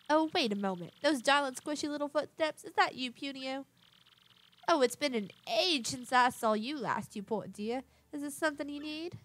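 lightly voiced genteel lady, doting; fussy fond madam. Madam Flurry greeting little Punio, lightly voiced doting madam. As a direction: put on a touch of a grand madam.

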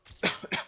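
short dry cough. Real, not acted. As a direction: clear the throat with a cough. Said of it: "Excusez-moi, je tousse un peu".

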